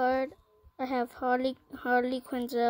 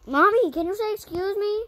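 high, loud call across the room; calling out to mom; 9 semitones higher, 4 dB louder than usual. Real, not acted. Kid calls out to his mom mid-recording, loud and high.

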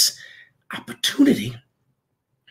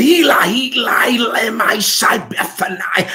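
shouted high in anguish; Jesus' cry from the cross; 4 semitones higher than usual. Acted. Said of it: "He said".

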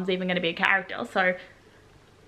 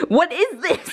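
surprised; 7 semitones higher, slower than usual. Real, not acted. Confused reaction while watching.

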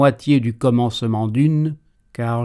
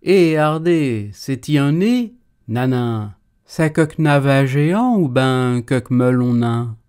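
put-on country yokel accent; 3 dB louder than usual.

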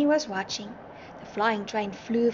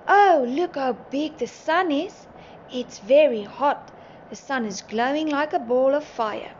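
ordinary delivery

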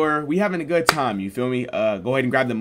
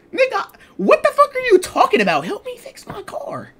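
much higher, indignant outburst; exasperated; 6 dB louder than usual. Retold or imitated. Son snaps back at his nagging dad, high indignant outburst. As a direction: squeal it in outrage.

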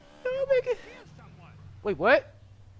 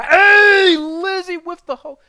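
sudden high, loud cry; startled yell; 20 dB louder, slower than usual. Real, not acted. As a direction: jump out of your skin. Startled by a game event.

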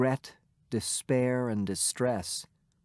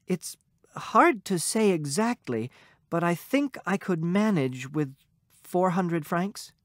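unsure; 5 semitones higher than usual. A narrator's reading. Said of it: "not too sure of herself".

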